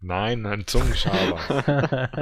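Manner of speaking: slow and loud, every syllable of Zungenschaber stretched; emphatic correction. Real, not acted. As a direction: spell it out slowly and firmly. Correcting a wrong guess.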